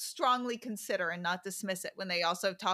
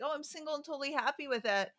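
higher, fast, falsely cheerful; mock breezy denial; 6 semitones higher, faster than usual. Mocking lonely singles who pretend to be fine, breezy and fake-cheerful. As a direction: rattle it off like a fake-happy single. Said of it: "instead of just like".

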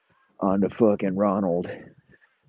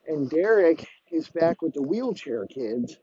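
ordinary talk, nothing marked

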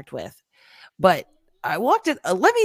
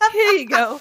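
sassy here you go; 4 semitones higher, 7 dB louder than usual. Pretend. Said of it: "And I'm like".

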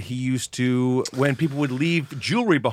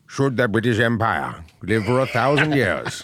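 low, slow wartime speech voice; solemn slow oratory, deep and grave; Winston Churchill; 5 semitones lower, slower than usual. Shown as a demonstration. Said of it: "like Winston Churchill".

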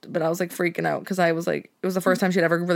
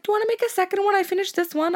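much higher sweet-voiced ask; cheeky roommate; 13 semitones higher than usual. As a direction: chirp it like an innocent snacker. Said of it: "She's like".

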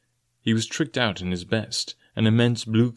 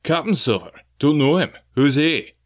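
higher, louder scornful cry; 5 semitones higher, 4 dB louder than usual. Acted. Captain scorning Silver's new rank, loud and higher. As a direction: cry it out, mocking the title. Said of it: "cried the captain".

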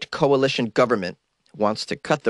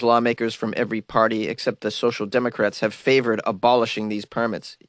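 ordinary talk

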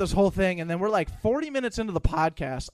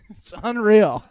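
laughing; 3 semitones higher, 7 dB louder than usual. Real, not acted. Laughing over the liquor story.

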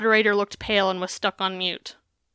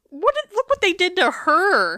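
indignant outburst; 10 semitones higher, 4 dB louder than usual. Real, not acted. Indignant at online commenters.

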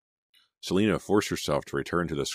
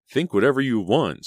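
high, loud angry retort; 8 semitones higher, 7 dB louder than usual. A narrator's reading. Selena told Danny off, angry. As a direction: snap back at an insult. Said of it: "Selena told him angrily".

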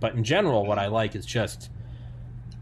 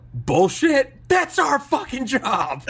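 high, loud, slow laughing punchline; sarcastic yell; 6 dB louder, slower than usual. Retold or imitated.

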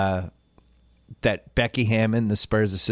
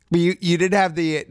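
ordinary delivery

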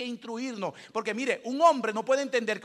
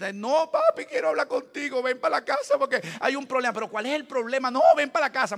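mock insistent wife; 5 semitones higher, 7 dB louder than usual.

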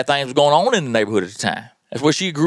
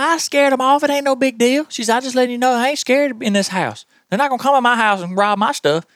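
tough grandma; 9 semitones higher than usual. Retold or imitated. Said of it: "She's like".

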